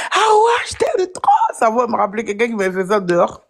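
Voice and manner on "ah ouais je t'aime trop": high-pitched shouted quote; people shouting. Retold or imitated. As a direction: call it out like a fan in the street. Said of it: "qui me disent". (crié depuis la rue, voix aiguë)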